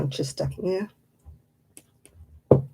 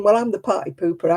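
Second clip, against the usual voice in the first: ordinary delivery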